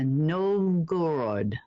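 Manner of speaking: Novgorod said slowly in a Russian accent. Shown as a demonstration. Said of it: "trying to say that correctly in my Russian accent".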